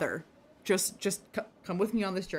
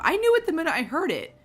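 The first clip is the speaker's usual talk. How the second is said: fast, much higher excited rush; 10 semitones higher, 7 dB louder, faster than usual. Real, not acted. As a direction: rush it out in triumph. Excited boast, fast and high.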